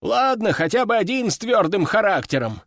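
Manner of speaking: plain reading voice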